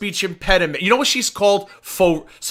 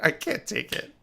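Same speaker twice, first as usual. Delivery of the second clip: breathless from laughing; 5 semitones higher, 10 dB quieter than usual. Real, not acted.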